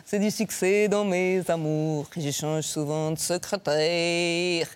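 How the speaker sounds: put-on slow, lightly done Belgian accent; a hint of Belgian accent, drawn out. Suggesting Lambert's role, light Belgian accent. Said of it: "tu fais l'accent belge".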